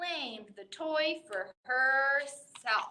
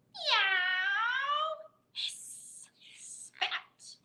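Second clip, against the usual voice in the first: high meow then a breathy hiss; cat meow and hiss; 9 semitones higher, much slower than usual. Acted. Reader voicing the cat hissing at the dogs, high meow then hiss.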